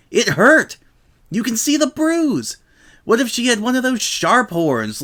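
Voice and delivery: high, indignant whiny protest; aggrieved protest. A narrator's reading. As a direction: plead your case, hurt.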